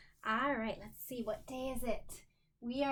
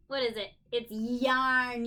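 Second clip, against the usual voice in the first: ordinary delivery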